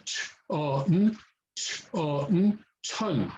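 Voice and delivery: "ch, ah, n" as separate sounds, then blended "chun"; sounding it out. Shown as a demonstration. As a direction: break the syllable into three, airy "ch" first. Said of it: "Aspirating that first one".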